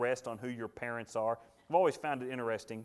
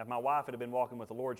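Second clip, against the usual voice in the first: usual speaking voice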